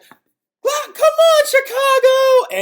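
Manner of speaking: high, loud, stretched rallying cry; mock arena hype-up, voice pushed way up; hammed-up Brie Bella. Vlogger mocking Brie firing up the crowd, shrill high yell. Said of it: "She was like".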